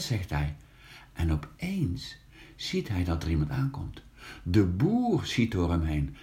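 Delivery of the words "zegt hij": ordinary delivery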